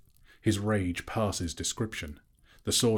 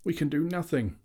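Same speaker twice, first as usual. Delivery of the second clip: despairing moan; 10 semitones higher than usual. A narrator's reading. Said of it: "moaned the others".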